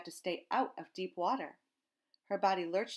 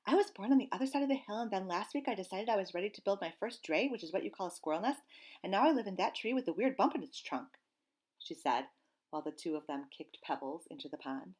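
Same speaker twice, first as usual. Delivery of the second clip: rambling squirrel; 3 semitones higher than usual. Acted. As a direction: rush it out, too nervous to stop. Squirrel tells her stories.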